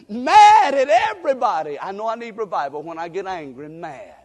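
usual speaking voice

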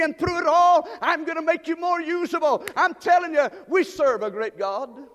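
preaching at full force. Acted. Pastor rousing the church about storms, in loud preaching. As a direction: lift the room with every whatever.

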